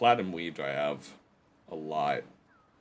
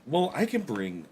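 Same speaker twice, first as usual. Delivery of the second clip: usual speaking voice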